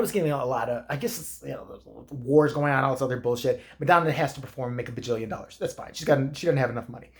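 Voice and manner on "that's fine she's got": ordinary talk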